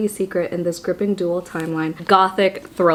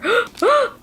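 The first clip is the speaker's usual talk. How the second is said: excited gasp; 14 semitones higher than usual. Real, not acted.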